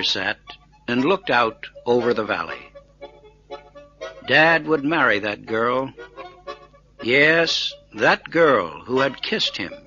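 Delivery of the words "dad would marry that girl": plain reading voice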